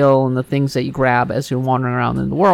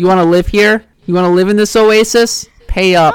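louder, higher mock mafia shakedown; mock friendly threat; 4 semitones higher, 6 dB louder than usual. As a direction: lean in like a racketeer demanding payment. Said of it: "it's more like the mafia".

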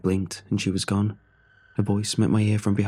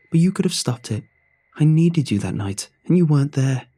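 louder and higher accusation; rising anger; 6 semitones higher, 5 dB louder than usual. Acted. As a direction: hurl the blame at him, voice climbing. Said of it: "Her face was now tinged with anger".

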